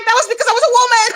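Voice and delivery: fast, high, laughing exclamation; laughing outburst. Real, not acted. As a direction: rush the punchline while cracking up. Punchline of funny story.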